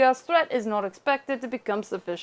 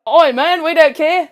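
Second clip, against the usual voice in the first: loud, Aussie-sounding Kiwi impression; higher, louder mock New Zealand accent; bad New Zealand accent; 4 semitones higher, 9 dB louder than usual. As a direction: botch a Kiwi accent so it lands Australian. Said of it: "that sounds a little bit Aussie".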